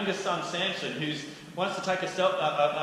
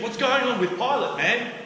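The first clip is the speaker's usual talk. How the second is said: much higher and louder, scoffing; incredulous mockery; 10 semitones higher, 6 dB louder than usual. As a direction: sneer in disbelief. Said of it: "They're saying".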